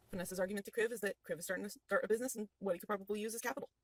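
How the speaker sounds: usual speaking voice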